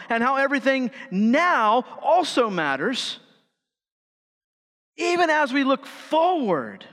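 high, driving sermon delivery; impassioned preaching. Acted. Preaches at full force.

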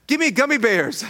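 put-on high, loud child's demand; small boy insisting on candy; demanding little son. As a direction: bark it like a bossy toddler. Voicing his young son demanding.

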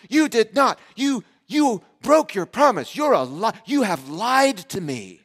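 put-on high, loud, slow child shouting; kid's outraged accusation. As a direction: wail it like a betrayed five-year-old. Son accuses dad of lying.

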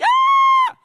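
sudden high shriek of fright; startled scream. Retold or imitated. Disciples seeing Jesus appear, a startled shriek. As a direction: shriek like a ghost just appeared. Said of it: "You're gonna be like".

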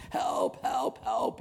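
high-pitched cry for help; acted, high yell for help. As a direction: call out for rescue from a cliff. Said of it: "he's yelling for help".

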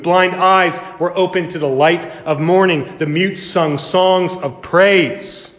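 impassioned preaching. Acted. Preacher to congregation, rising impassioned preaching. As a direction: build the crowd to a peak.

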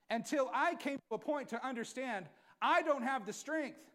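loud, rising sermon delivery; full-force preaching. Acted. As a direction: drive the message home to the church.

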